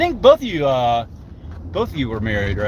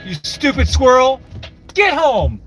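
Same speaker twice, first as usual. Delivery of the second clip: exasperated parental yell, pitched high; scolding yell; 8 semitones higher than usual. Acted. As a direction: holler like you're chasing naughty kids off the road. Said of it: "then I yell at 'em like they're my kids".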